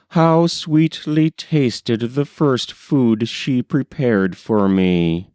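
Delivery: ordinary delivery, nothing marked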